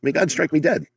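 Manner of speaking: insistent barfly. Pretend. Mocking the Pope's brother at a bar.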